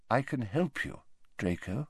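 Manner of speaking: gentle offer. A narrator's reading. Headmaster offering Draco help, soft and tender. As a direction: offer a hand quietly.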